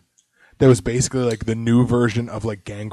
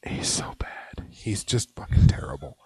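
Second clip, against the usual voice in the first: whispering; 3 semitones lower, 5 dB quieter than usual. Real, not acted. Trashing a wrestler.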